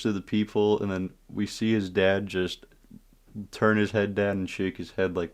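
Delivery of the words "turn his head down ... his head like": usual speaking voice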